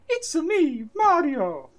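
high-pitched cartoon plumber voice; Mario. Reluctant friend auditioning for the role, high Mario voice. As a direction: chirp it high like an Italian game hero. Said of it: "'cause I'm bad at voices".

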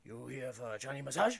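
a hint of a Chinese masseuse; Chinese accent. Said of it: "she goes".

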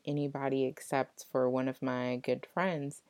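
usual speaking voice

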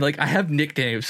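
ordinary delivery

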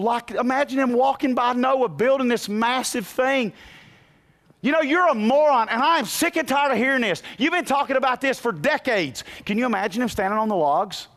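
high, loud sermon cry; soaring pulpit preaching; full-force preaching. Acted. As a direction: preach it out to the rafters.

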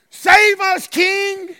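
high, loud acclaiming cry; crowd's shout. Retold or imitated. Preacher translates Hosanna for the congregation, shouted. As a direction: cry out to a passing king. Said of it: "They were shouting".

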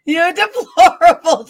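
high, slow echo of a scolding impression; laughing mimicry. Host echoing her guest's political impression, amused mimicry. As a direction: draw it out, mocking through laughter.

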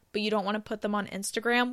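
usual speaking voice

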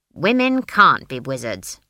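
low, loud, blunt granny. A narrator's reading. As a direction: state it bluntly and forcefully. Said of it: "said Granny bluntly".

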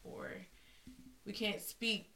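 normal talking voice

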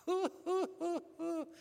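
high hooting laugh aimed at the front row; a mocking high hoo-hoo. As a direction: hoot like a preacher whipping up laughter. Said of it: "he'd look at 'em and he'd say".

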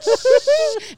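high, loud "shh" noise; imitated scraping hiss.

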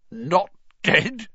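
high, choked disbelief; strangled, high-pitched rising question. A narrator's reading. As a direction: choke out the question through tears. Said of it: "choked Filch".

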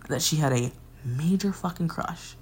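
usual speaking voice

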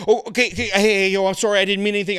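high, hasty backpedalling; mildly panicked placating; flustered apology. Retold or imitated. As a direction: stammer out a retold apology. Flustered apology to crying dancer.

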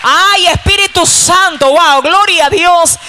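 high, loud praise shout; fervent preaching cry. Real, not acted. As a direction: cry out to rouse the church. Preacher cries out to congregation.

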